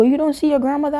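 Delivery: high, puzzled question; puzzled hypnotist. Retold or imitated. Hypnotist surprised she sees nothing, high puzzled. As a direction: ask with surprise. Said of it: "The man go".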